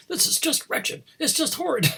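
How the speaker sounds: high, airy mock voice of a passing woman; passerby's complaint. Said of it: "and she said".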